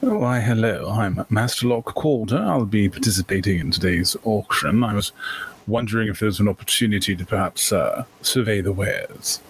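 put-on cultured auction-goer with a college accent; courteous gentleman merchant. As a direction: introduce yourself with polite old-world formality. Player speaks as Master Locke Calder.

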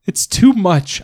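the repeat breaks further into a sob; crying harder. Acted.

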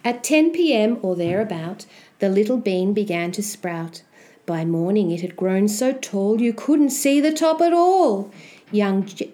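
ordinary delivery

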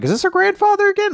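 high, fast, puzzled question; mock bewildered squeak; confused. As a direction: blurt it out in a high, confused rush. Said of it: "for a moment I was like".

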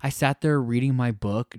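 normal talking voice

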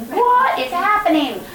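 retold amazement, half laughing; disbelieving. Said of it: "again, I was like".

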